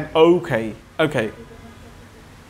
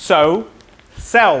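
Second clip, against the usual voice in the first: American accent; 4 semitones higher, 4 dB louder than usual. Shown as a demonstration. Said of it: "আওয়াজটা আসছে না".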